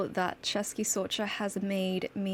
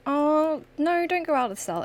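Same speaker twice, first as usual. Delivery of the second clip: alarmed outburst; 8 semitones higher, 5 dB louder than usual. Real, not acted. Player alarmed at game.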